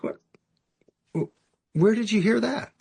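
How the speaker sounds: high, incredulous question; baffled disbelief. Retold or imitated. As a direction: voice leaps up, taken aback and stammering. Grand sponsor baffled by supposed rule.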